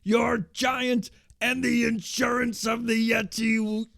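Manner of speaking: high, loud, drawn-out promo wail; hammed-up screaming cult-leader promo; screaming Master voice. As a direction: bellow each phrase slowly, holding the pitch up. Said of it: "He's just screaming".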